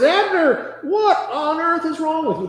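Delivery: high-pitched calling out, no real volume; mock yell. David calling across to Abner, mock yelling. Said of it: "he starts yelling at Abner".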